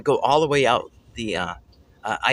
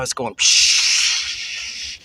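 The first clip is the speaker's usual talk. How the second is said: steam hiss imitation; 3 dB louder, much slower than usual.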